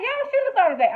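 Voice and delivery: high, loud scolding; exasperated. Real, not acted. Vlogger scolding the characters, high and loud. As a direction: call them out, fed up. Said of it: "I was like".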